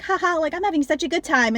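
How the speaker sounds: high, giddy mock laugh into a gleeful line; a pleased, carefree brat; mocking Daisy. Daisy gloating while Lou cries, giddy mock voice. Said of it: "running around naked in the house being like".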